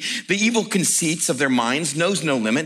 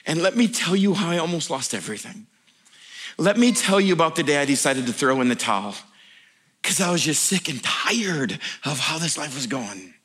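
ordinary talk

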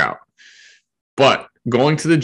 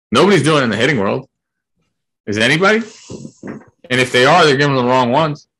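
loud emphatic; 6 semitones higher, 6 dB louder, faster than usual. Real, not acted. Heated argument peak.

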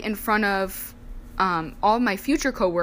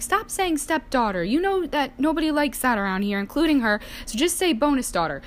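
higher, nagging mom voice, mildly put on; a lightly voiced insistent mother; imitating mom; 7 semitones higher than usual. Mom correcting her daughter's wording, higher and insistent. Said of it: "my mom was like".

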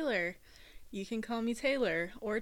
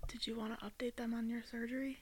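whispered prompt; 8 dB quieter than usual. Real, not acted. Co-host prompting quietly.

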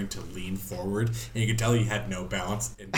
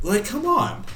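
exasperated; 13 semitones higher, 8 dB louder than usual. Real, not acted. Exasperated at the fall.

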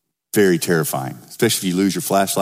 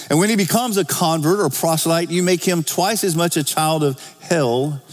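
raised-pitch Bible reading; preacher quoting scripture aloud; 6 semitones higher than usual. Acted. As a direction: read the verse out to the congregation, pitched up.

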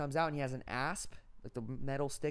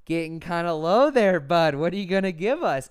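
mocking taunt; 4 semitones higher, 12 dB louder than usual. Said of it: "and I was like".

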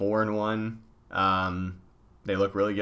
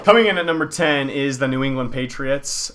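top ten countdown; 5 semitones higher, 7 dB louder than usual. Acted. Announces power rankings countdown.

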